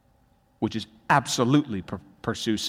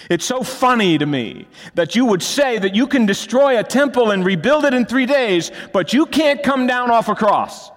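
loud, high sarcastic jeer; mocking shout; 10 semitones higher, 7 dB louder than usual. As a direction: jeer at him from the foot of the cross. Mocking the crucified Jesus.

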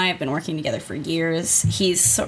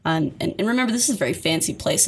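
usual speaking voice

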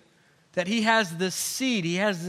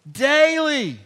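a loud, falling "Daily"; emphatic daily; 5 semitones higher, 5 dB louder than usual. Real, not acted. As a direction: punch "Daily" as the answer.

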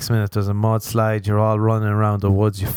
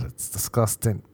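ordinary talk, nothing marked

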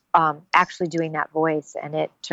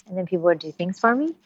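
normal talking voice

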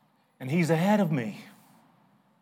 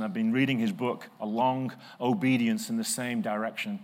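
usual speaking voice

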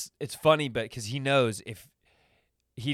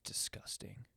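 hushed, disgusted whisper; 17 dB quieter than usual. Pretend. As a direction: breathe out the disgust. Judgy caveman reacting.

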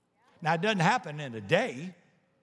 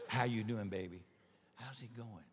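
soft, low, tender aunt voice; 6 semitones lower, 9 dB quieter than usual. Retold or imitated. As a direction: coo it like a loving aunt rubbing your back.

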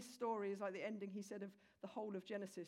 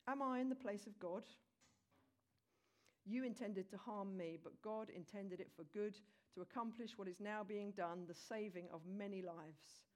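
a softer, lower Bible reading voice; scripture reading; 3 semitones lower, 3 dB quieter than usual. Acted. Preacher reads Joseph's reassurance to the congregation, in a soft reading voice. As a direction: read the passage gently, letting it settle. Reads Genesis passage aloud.

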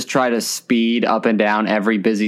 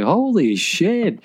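high, drawn-out exclamation; retold astonished reaction, slow; stunned amazement; 8 semitones higher, much slower than usual. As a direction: stretch it out in awed disbelief. Retells his stunned reaction.

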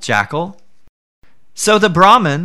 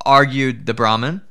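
ordinary delivery, nothing marked

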